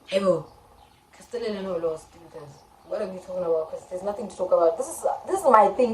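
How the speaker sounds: usual speaking voice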